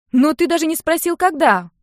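high, loud complaint; indignant. A narrator's reading. Sister protests uncle's instant refusal, indignant. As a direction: object sharply, pitch jumping up. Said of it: "возмутилась сестра".

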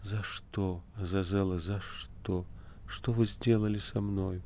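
faint, soft, barely voiced plea; weak quiet question. A narrator's reading. Margarita weakly asking Azazello why, softly. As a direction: ask it weakly, drained of strength. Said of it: "слабо спросила".

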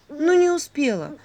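high, drawn-out sigh of excuse. A narrator's reading. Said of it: "вздохнула Василиса". (со вздохом, протяжно)

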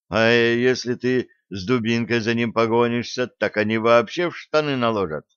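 ordinary delivery, nothing marked

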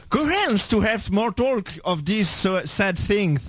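put-on slow, affected British accent; accent british insupportable. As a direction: draw out an overdone British English. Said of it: "Il a un accent british insupportable". (accent british affecté, très traînant)

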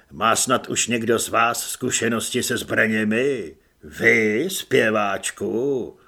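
low, loud, measured sergeant's voice; commanding sergeant. A narrator's reading. Sergeant briefing new recruits on the march, commanding. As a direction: address the troops low and deliberately.

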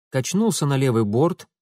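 usual speaking voice

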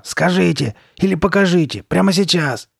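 high, loud insistence; urgent demand. A narrator's reading. Girl pressing the shopkeeper for an answer, urgent demand. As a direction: demand it in desperation.

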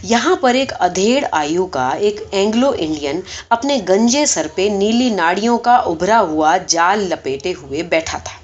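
plain reading voice

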